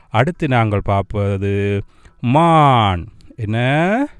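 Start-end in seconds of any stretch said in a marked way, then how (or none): none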